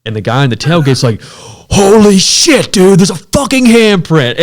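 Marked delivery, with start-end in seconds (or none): 1.2-4.4 high, loud, slow horrified cry; terrified shout. Retold or imitated. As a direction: scream in horror at the discovery. Guy finds handprint, terrified.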